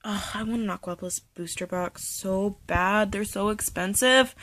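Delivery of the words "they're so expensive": usual speaking voice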